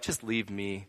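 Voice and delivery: the woman at the standoff, quiet and defeated; weary plea. Retold or imitated. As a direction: let it fall away, worn out. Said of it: "she's now just at the standoff like".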